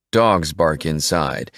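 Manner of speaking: ordinary delivery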